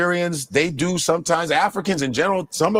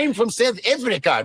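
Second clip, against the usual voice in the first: put-on South African accent; 4 semitones higher than usual.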